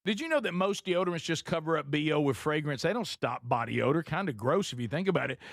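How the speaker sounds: upbeat sponsor read. Acted. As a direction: sell it like a radio ad. Reads sponsor ad.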